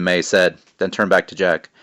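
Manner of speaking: plain reading voice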